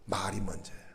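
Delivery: whisper. Real, not acted. Opening hushed statement of theme.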